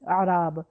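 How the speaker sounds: اعراب said "a-raab" with a falling tune; اعراب with an open a, meaning bedouins; araab with a. Shown as a demonstration. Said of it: "ہم اس کو زبر سے پڑھتے ہیں". (اعراب زبر سے)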